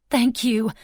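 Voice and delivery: high, loud excited squeal. Acted. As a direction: squeal it out with joy. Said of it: "I squealed".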